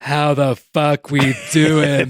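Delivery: slow, loud drawn-out crowd greeting; hungover top voice. Pretend. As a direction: stretch it out like a concert hype call. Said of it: "My hungover top voice".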